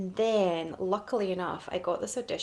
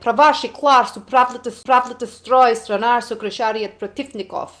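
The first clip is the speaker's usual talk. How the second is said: loud, hammed-up fake Russian, pitched higher; mock Russian accent; 4 semitones higher, 15 dB louder than usual. Actress reciting her old audition lines in a loud fake Russian accent. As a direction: belt out made-up Russian lines in a put-on Russian accent. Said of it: "it went something like".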